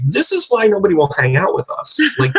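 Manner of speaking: mock scolding through a laugh; half-laughing tease of a sister. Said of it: "starts laughing and say".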